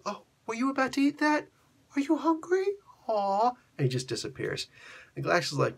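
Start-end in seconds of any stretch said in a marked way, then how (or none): 0.0-3.5 very high, cooing fake sympathy; mocking taunt. Thanos taunting Galactus after stealing his planet, mock pity. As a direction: tease in a put-on high little voice.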